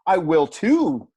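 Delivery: TOO held long and loud, slow; emphatic too. Shown as a demonstration. Teacher demonstrating emphatic future, stressing TOO. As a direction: lean hard on TOO in "I will too". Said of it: "you could even have that emphatic".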